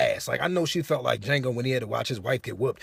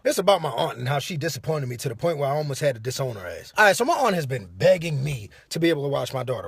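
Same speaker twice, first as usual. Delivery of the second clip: usual speaking voice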